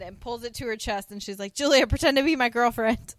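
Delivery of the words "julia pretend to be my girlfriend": loud, higher acted plea; mock panic. Mary Jo grabbing Julia's hand to dodge a stranger, mock panic. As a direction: urgently beg for cover, raised voice.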